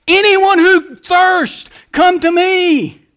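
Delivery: high, loud and slow, drawn out; proclaimed call. Acted. Preacher voicing Jesus inviting everyone, loud and slow. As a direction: ring it out as an invitation.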